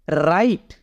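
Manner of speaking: राइट with the W silent, starting on R. Shown as a demonstration. Teacher showing how write is said, राइट with silent W. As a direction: say राइट beginning straight on the R sound. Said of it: "इसको हम बोलेंगे".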